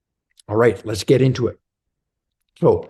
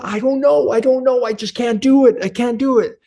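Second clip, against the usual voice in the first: much higher, helpless flustered voice; panicky stuck man; 12 semitones higher than usual. Retold or imitated. Man failing to pour coffee in front of his friend, panicking in a much higher voice. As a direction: squeak out the helplessness of a man who can't pour.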